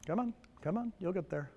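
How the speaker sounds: quietly urging the machine along; muttering to the computer. Real, not acted. Coaxing a slow slide.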